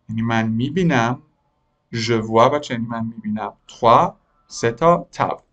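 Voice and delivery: ordinary talk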